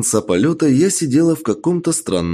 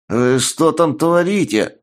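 higher, slow annoyed outcry; annoyed exclamation; 7 semitones higher, slower than usual. A narrator's reading. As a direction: call out in irritation. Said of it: "раздался недовольный возглас какой-то тётки".

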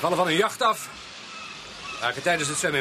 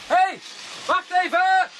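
yelling after Beaumont as he runs off; calling out; 11 semitones higher, slower than usual. Acted. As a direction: shout to stop him from a distance. Calling after a running man.

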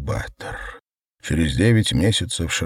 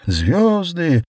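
high squeal, pitched far up and louder; shriek; 10 semitones higher, 3 dB louder than usual. A narrator's reading. Mother squeals while hugging her son, a high shriek. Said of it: "взвизгнула Светлана".

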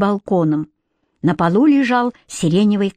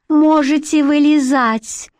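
higher, slow regal voice; gentle queen; 6 semitones higher, slower than usual. A narrator's reading. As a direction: speak as a kind queen.

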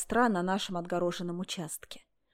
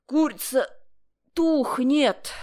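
higher and louder, insistent warning; raised, pressing repeat; urgent alarm; 6 semitones higher, 6 dB louder, slower than usual. A narrator's reading. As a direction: sound the alarm about the chicken. Repeated alarm about the chicken.

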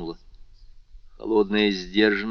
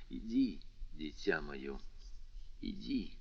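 much softer, fading to a whisper; a tender send-off to the child; тихо и нежно; 3 semitones lower, 14 dB quieter, slower than usual. A narrator's reading. Father gently sends son away. (нежно, почти шёпотом)

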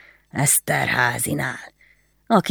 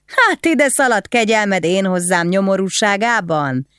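high, mocking drunken taunt; mocking laugh; 6 semitones higher than usual. A narrator's reading. Drunk husband mocking his wife, laughing. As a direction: laugh it out, sneering at her. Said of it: "elröhögte magát".

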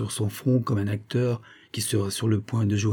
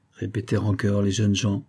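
plain reading voice

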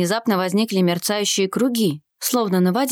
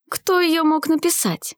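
high, drawn-out sceptical question; wry scoff; 7 semitones higher than usual. A narrator's reading. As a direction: scoff lightly, stretching the question out. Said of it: "Усмехнулся Арсений".

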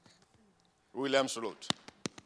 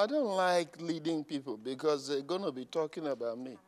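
mock shy member; 5 dB quieter than usual. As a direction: mumble like a shy churchgoer. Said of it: "shy member voiced".

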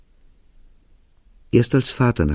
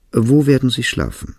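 higher-pitched, more pointed question; changed tone; 5 semitones higher, 4 dB louder than usual. A narrator's reading. Monk interrupts the father about lodging, in a changed tone. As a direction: switch tone and raise the pitch to ask. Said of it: "änderte der Mönch seinen Ton und fragte".

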